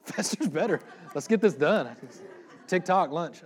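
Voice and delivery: high, laughing mock impatience; joking hurry-up. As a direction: play at rushing them, laughing. Joking about rushing the sermon.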